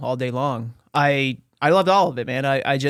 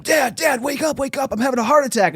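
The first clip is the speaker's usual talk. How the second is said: high, urgent retold cry for help; acted panic; 11 semitones higher than usual. Teenager waking his dad over chest pain, panicked. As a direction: re-enact a frantic wake-up plea.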